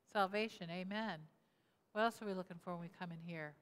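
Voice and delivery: normal talking voice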